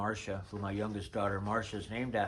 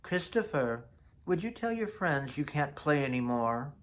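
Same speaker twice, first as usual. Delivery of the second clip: imitating Faith; 5 semitones higher than usual. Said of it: "that's how she said my name".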